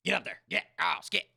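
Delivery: sharp, loud calls at the cat; shooing the cat. Real, not acted. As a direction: raise your voice to chase the cat off. Shooing the meowing cat away.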